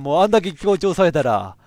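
normal talking voice

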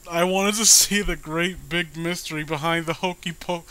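real giggles spilling into the words; laughing through it. Real, not acted. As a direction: try to read the line but the laugh won't stop. Rereads line while still laughing.

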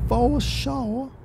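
high, soft put-on voice; silly echo. Driver echoing his own words, silly high voice.

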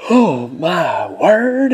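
slow, loud, drawn-out gasp of surprise; surprised exclaim. Real, not acted. Collector pulling a great card, loud slow surprise. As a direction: let it burst out, stretched.

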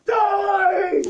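loud playful yell; shout. Pretend. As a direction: yell it out for fun. Shout while seeker approaches.

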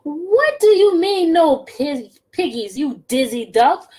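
high, loud witch screech; cranky witch scolding the duck; witch yelling. Acted.

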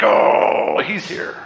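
long groaned "oh", then hushed; acted sinking dread at an arrival; anxious dread. Family as dad comes home, hammed-up dread. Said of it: "stress and anxiety and fear".

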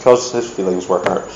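normal talking voice

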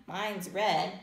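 ordinary delivery

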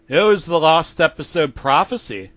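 plain reading voice

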